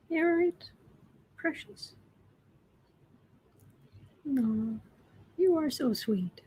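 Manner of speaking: high, soft cooing; tender high pet voice; pet talk. Real, not acted. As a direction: coo softly to the kitten. Talking to the kitten.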